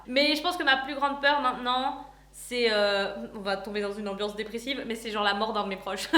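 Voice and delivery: normal talking voice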